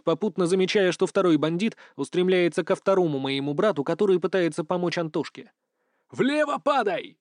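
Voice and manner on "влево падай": urgent yell, slow and high; shouted command. A narrator's reading. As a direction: bark a warning to drop. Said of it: "кричу я". (крик-команда)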